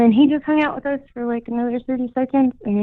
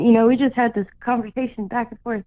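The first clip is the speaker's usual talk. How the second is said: ordinary talk, nothing marked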